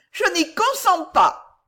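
very high, drawn-out protest; fainting lady. A narrator's reading. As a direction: refuse in a loud swooning wail. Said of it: "la dame évanouie".

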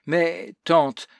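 mild moaning protest; moaning plea. A narrator's reading. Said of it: "gémit-elle enfin".

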